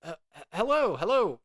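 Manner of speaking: high-pitched, hesitant call over crowd noise; Duncan. Acted. Adventurer asks a stranger for help over the noise, high and flustered. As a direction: stammer out questions high, straining to be heard. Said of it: "over the noise, Duncan will be like".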